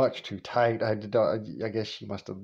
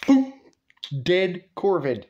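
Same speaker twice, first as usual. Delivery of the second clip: normal talking voice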